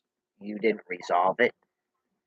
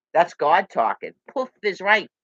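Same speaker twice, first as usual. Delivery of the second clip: ordinary talk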